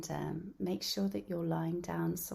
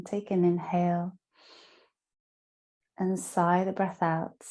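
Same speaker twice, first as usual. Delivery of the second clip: guided meditation; 10 dB louder than usual. Acted. Yoga teacher guiding listeners lying down through Yoga Nidra, guided meditation. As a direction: guide them down into rest, phrase by phrase.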